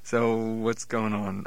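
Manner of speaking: ordinary delivery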